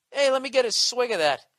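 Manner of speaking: acted moocher. Drinker asking to share a beer, loud mock pleading. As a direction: call out as a cheeky moocher.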